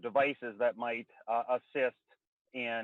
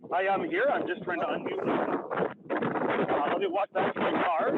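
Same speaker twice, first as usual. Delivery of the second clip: raised voice over gusting wind on a phone; calling in from outdoors, louder and higher through wind noise; windy background noise; 5 semitones higher, 4 dB louder than usual. Real, not acted. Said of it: "the windy background noise".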